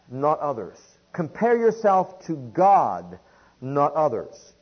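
usual speaking voice

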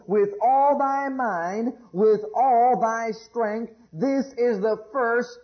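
slow, solemn reading of the verse; scripture reading. Acted. Preacher reading Jesus' words to the congregation, slow and solemn. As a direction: lay out each thy slowly.

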